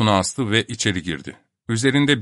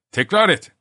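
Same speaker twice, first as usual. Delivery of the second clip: high, loud threatening order; angry command; 9 semitones higher, 4 dB louder than usual. A narrator's reading. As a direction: bark it through clenched teeth. Doctor threatening while choking. (öfkeli emir)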